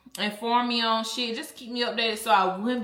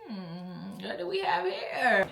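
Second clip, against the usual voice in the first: sneaky snooping voice; 3 dB quieter, slower than usual. Retold or imitated. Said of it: "I'm like".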